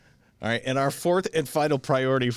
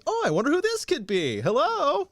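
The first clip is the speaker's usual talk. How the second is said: higher, brightly curious phone greeting; happy and surprised; 13 semitones higher than usual. Retold or imitated. Cohost imitating an old-time caller answering, happy and surprised. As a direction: pick up the phone delighted to see who it is. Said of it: "happy and surprised to answer".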